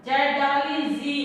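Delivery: "जेड" clearly with a buzzing z; z sound spelled out. Shown as a demonstration. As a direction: spell out "जेड डबल ई जी" slowly, starting with z. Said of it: "इस जी के नीचे नुक्ता लगा दिया". ("जेड" में ज़ की गूँजती ध्वनि, धीरे-धीरे)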